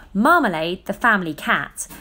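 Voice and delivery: ordinary delivery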